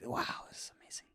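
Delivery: hushed, breathless wonder; awed whisper. Retold or imitated. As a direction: whisper it like you can't believe the view. Awestruck whisper quote.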